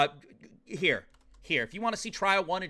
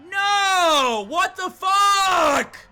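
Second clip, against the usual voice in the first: higher, louder cry of disbelief, last words dragged out; anguished shout; 9 semitones higher, 8 dB louder, much slower than usual. Real, not acted. Streamer watching the good priest die, anguished shouted cry. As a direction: scream it as the favourite dies. Priest character just executed.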